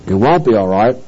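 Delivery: low-pitched, sober denial; firm contradiction. Real, not acted. Preacher refuting the organizations' promise, firm contradiction.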